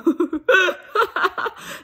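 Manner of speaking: real high laugh burst; loud laugh. Real, not acted. Laughing about German rappers.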